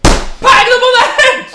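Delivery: very high, very loud, slow holler; mock shout. Mocking poster's patronising politicians, hammed-up shout. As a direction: shriek it in hammed-up condescension.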